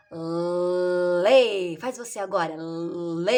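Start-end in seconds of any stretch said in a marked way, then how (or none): 0.1-1.8 long hummed "L" sound released into "lê". Shown as a demonstration. Teacher showing her mouth to pupil, long "L" into "lê". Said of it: "Pra ficar mais audível pra ela". 2.6-3.4 "lê" with a long held l, low; sounding out. Shown as a demonstration. Child's turn to copy the teacher, "lê" with stretched l. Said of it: "Pra ficar mais audível pra ela".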